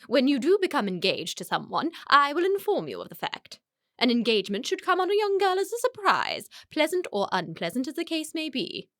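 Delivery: high, swooping, haughty declaration; imperious pronouncement. Acted. Mother forbidding her daughter's engagement, imperious. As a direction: lay down the law to a daughter, grandly.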